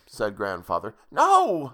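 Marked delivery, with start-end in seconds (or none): 1.1-1.7 high-pitched yelp of protest; yelled refusal. A narrator's reading. Child refusing to leave Grandfather behind, yelled. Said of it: "I yelled".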